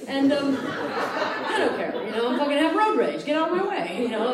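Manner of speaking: ordinary talk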